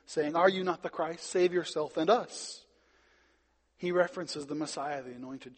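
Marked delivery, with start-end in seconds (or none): 0.4-2.6 raised, louder retold taunt; mocking criminal. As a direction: imitate a scoffer's challenge, pitched up. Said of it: "The one who mocked him".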